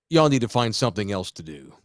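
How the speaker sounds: lightly voiced unimpressed mother, pitched low; low-key mock scolding; deadpan mom. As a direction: imitate a mom dryly scolding. Voices mom catching the teenagers.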